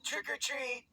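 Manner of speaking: high-pitched doorstep call; trick-or-treat call. Acted. Kids calling out at a door.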